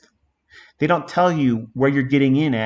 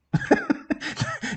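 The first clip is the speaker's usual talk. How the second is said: high airy laugh; 6 dB quieter than usual. Real, not acted. Laughs at own exaggeration.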